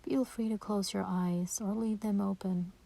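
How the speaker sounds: normal talking voice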